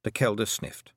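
ordinary delivery

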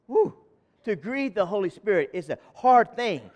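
ordinary talk, nothing marked

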